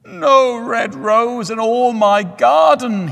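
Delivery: high, loud, slow anguished cry; the young student cries out in grief. A narrator's reading. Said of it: "he cried".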